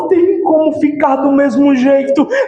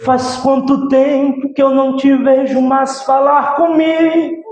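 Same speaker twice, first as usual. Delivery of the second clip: Jesus speaking; 6 semitones lower, 3 dB quieter than usual. Acted. Preacher voices Jesus softly.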